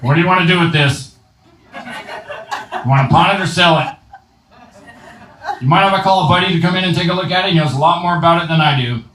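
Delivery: strained Rick impression. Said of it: "He's always like this".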